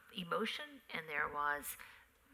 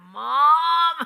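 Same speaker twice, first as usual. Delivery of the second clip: loud, high drawn-out whine; exasperated kids; 10 semitones higher, 20 dB louder than usual. Retold or imitated. Kids begging mother to drop the accent, loud protesting yell. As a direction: yell it like an embarrassed child. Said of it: "The kids would be like".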